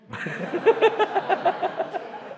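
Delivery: long real high laugh; sheepish real laughter. Real, not acted. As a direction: laugh at being caught out. Laughs at wife's remark.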